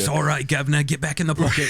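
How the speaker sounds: light Cockney coaxing a pet; mock Cockney. Henchman coaxes his mouse back into his pocket, light mock Cockney. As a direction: soothe the mouse like a London crook.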